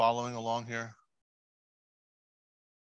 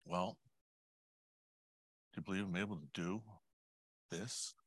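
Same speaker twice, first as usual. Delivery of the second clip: hushed, tender question; very soft, pausing before the final word; still small voice; 9 dB quieter than usual. Acted. God answering one praying for orphans, softly. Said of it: "that still small voice coming back and saying".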